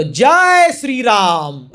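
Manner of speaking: long, drawn-out devotional war cry; rousing battle cry. Acted. Hanuman rising up to leap for Ram, rousing battle cry. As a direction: stretch the chant out like a rallying cry. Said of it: "ऐसे करके पूरा खड़े हो गए".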